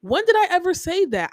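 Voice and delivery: high, loud outraged retort; retelling a heated protest with real heat; indignant. Retold or imitated. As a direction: fire back in disbelief, raising your voice. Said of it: "And I'm like".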